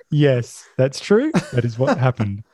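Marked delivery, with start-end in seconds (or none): none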